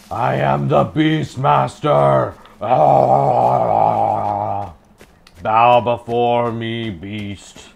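slow booming villain proclamation; dark lord. Pretend. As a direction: proclaim it slowly like a dark overlord. Gamer hams up the Beastmaster.

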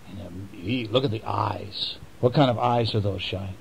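plain reading voice

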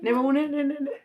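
high, loud, repeated urging. Real, not acted. Mother feeding her child mid-vlog, loud high urging.

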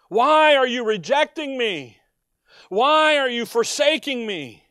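loud, pleading cry to the Father; a loud, forsaken plea; anguished cry. Acted. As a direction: cry out as if abandoned. Preacher voicing Christ's cry.

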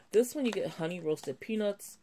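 ordinary talk, nothing marked

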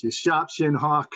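slow, laughing Boston accent with dropped r. Shown as a demonstration. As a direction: play up the Boston accent on sharpshin. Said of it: "My Boston accent is coming".

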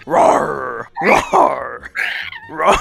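dinosaur voice. Pretend. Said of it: "I'm a dinosaur".

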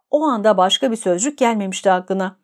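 ordinary delivery

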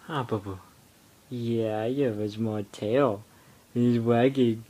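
ordinary talk, nothing marked

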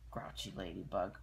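usual speaking voice

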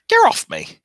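very high, loud squeaky yell; tiny creature shriek. Acted. Tiny creature yells from hole.

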